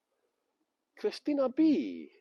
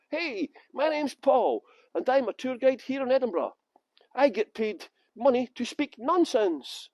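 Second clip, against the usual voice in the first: higher, drawn-out cheesy presenter voice; mock promo pitch; 4 semitones higher, slower than usual. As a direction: ham up a slow promo introduction. Mock take of his promo video.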